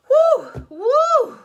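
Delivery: high, loud, laughing puffs; out of breath. Acted. Girl gasping after a fright, laughing breathless whews. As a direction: pant it out between giggles. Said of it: "I kept on breathing till I got my air back".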